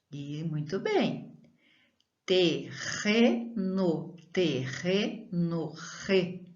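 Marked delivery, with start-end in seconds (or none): 2.3-5.7 "terreno" slowly, double R as a throaty h sound; slow teaching demo of a strong R. Shown as a demonstration. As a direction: stretch "terreno" with a strong guttural R.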